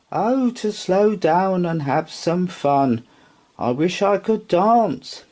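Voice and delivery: high, longing little horse; wistful horse voice. Acted. Rocking horse longing to trot, high and wistful. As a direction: sigh out the wish high.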